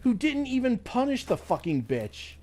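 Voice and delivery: high, heated retort; angry retort. Retold or imitated. Answering the new caseworker, high angry retort. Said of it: "I'm like".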